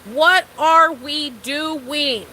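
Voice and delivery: very slow, each word stretched; drawn-out disbelief. Real, not acted. Asking about a teacher hugging girls, very slow and stretched. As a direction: drag it out in disbelief.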